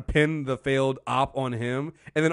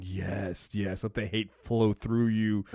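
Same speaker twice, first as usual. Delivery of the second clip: lower, much softer, hissing-free menacing purr of a villain on a throne; Emperor impression; 6 semitones lower, 5 dB quieter, slower than usual. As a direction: croon it like a gloating dark lord. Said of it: "the Emperor sitting on a throne going".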